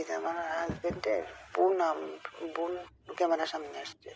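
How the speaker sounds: ordinary delivery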